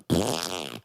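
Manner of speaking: mock fart raspberry noise; lip-buzzed fart impression; imitated fart. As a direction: blow a long raspberry to mimic passing gas. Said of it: "before you just start, like".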